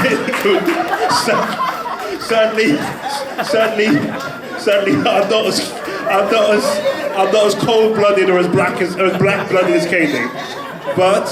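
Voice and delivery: high-pitched, loud, words squeezed out through laughter; can barely get the words out for laughing; laughing hard. Real, not acted. Cracks up at audience reaction.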